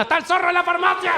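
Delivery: put-on high excited kid's cry; excited child. Little boy thrilled in the pharmacy, high excited cry.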